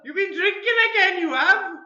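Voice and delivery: loud, high scolding Welsh wife; Welsh accent. Acted. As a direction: scold him in a sing-song Welsh accent. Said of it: "in a Welsh accent".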